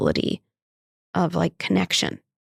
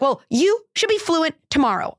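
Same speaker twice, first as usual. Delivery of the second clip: much higher, lightly mocking unrealistic demand; mock demanding teacher; 10 semitones higher than usual. Speaker mocking someone who demands instant Spanish fluency, high pitched. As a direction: sing out an impossible expectation. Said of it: "they were like".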